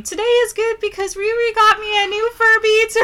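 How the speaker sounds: put-on high childlike reading voice; little nephew voice. Nephew reading his school journal entry, high and childlike. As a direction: read it like a proud kid. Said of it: "wrote in his journal, he's like".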